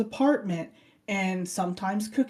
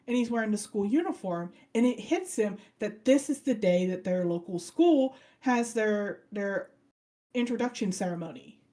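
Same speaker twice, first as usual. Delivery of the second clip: ordinary talk, nothing marked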